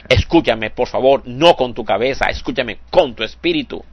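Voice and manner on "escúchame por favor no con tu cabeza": usual speaking voice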